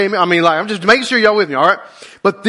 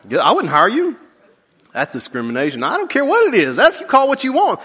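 normal talking voice

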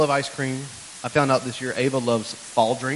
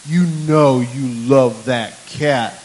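slow, loud retold insistence; drawn-out, forceful quoted claim; daughter's emphatic insistence; 8 dB louder, much slower than usual. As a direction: spell out each word as the insistent child. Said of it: "She goes like".

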